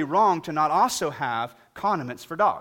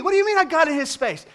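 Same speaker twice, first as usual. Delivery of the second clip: higher, louder incredulous "What do you mean I got in his space?"; indignant repeat; 13 semitones higher, 9 dB louder than usual. Retold or imitated. Repeating his question louder to the dog owner, incredulous. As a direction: ask it again, more outraged.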